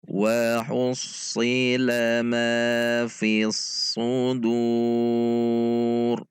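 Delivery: slow drawn-out chanted recitation; Quran recitation. Shown as a demonstration. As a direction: recite it melodically, holding each vowel long. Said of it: "Mari kita baca".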